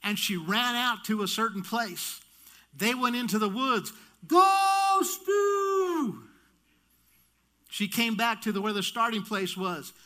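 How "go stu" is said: very high, loud, sustained holler; mom's cheering yell. Retold or imitated. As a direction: imitate a sports mom yelling to her son. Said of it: "she would yell".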